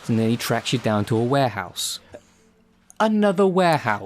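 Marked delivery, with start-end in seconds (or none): 3.0-4.1 high, fed-up groan of a complaint; sick of the same set again; exasperated. Real, not acted.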